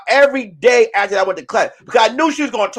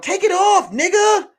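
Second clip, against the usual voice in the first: woman voice; 9 semitones higher than usual. Retold or imitated. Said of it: "I hear her in the background".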